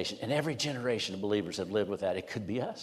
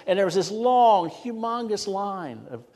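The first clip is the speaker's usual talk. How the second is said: "long, humongous" high and stretched out; emphatic exaggeration; 9 semitones higher, 3 dB louder, slower than usual. Real, not acted. Preacher describing the fans' queue, high drawn-out emphasis.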